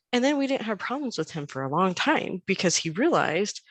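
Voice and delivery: ordinary talk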